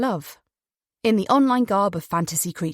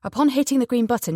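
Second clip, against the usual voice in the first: ordinary delivery, nothing marked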